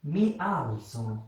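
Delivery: "Mi alzo" said very slowly, syllables drawn apart; slow repeat. Shown as a demonstration. Teacher repeating for students: very slow. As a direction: stretch each syllable for learners. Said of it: "কি বললাম আমি?".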